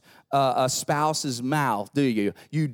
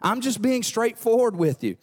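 defensive excuses; 8 semitones higher than usual. Retold or imitated. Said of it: "we defend ourselves with more words".

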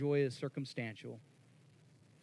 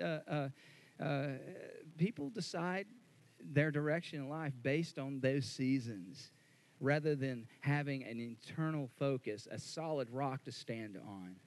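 ordinary talk, nothing marked

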